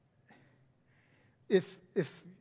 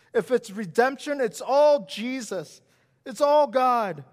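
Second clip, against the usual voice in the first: normal talking voice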